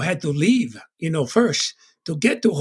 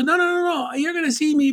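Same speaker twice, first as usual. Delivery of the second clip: reassuring mom; 10 semitones higher than usual. Retold or imitated. Mom reassuring son in retelling.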